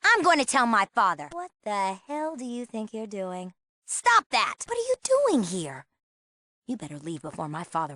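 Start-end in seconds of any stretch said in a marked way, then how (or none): 1.3-3.5 hushed, affronted demand; hushed indignation. Acted. Intruder while father sleeps. 3.9-5.9 high-pitched, indignant protest; startled, indignant challenge; alarmed scolding. Acted. Confronts an intruder.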